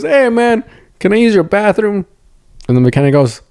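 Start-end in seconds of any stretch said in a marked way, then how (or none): 0.0-2.1 higher, easygoing put-on voice. Stranger asking the mechanic for the bathroom, breezy imitation. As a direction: ask casually in a put-on higher voice. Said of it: "That's what they sound like".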